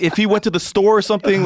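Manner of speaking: high-pitched, pleading argument; mock insistent bargaining; acted arguing. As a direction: reason with a friend excitedly. Friend argues for a shower.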